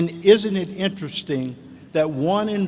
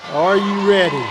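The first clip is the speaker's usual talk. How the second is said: rallying repeat; 3 semitones higher, 6 dB louder than usual. Shown as a demonstration. Speaker rallies the graduating class, louder call-out. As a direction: call out "Are you ready?" again to pump up the crowd.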